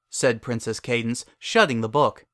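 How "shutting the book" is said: usual speaking voice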